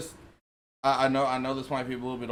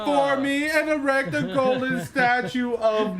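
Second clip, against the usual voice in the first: grand server god; 9 semitones higher, 4 dB louder, slower than usual. Pretend. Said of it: "I am now server god".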